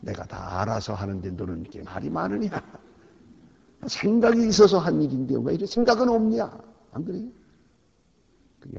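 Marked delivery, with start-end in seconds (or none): 0.0-6.5 building from calm to a loud, higher rebuke; mock divine rebuke; God scolding. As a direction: play God losing patience with a chatty believer. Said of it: "하나님이 그러실 거예요".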